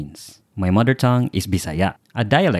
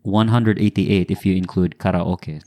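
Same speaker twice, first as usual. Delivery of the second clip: usual speaking voice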